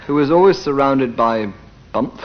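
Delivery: ordinary delivery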